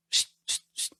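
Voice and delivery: brief, soft "shh" bursts, spaced apart; staccato hissing to call someone; short calling shushes. Shown as a demonstration. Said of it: "Y cuando tú dices".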